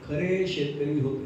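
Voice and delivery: खरे slowed and weighted; underlined word. Shown as a demonstration. Preacher underlining to listeners, खरे slow and heavy. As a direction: underline खरे as you say it slowly. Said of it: "अधोरेखित करून सांगावं लागतं".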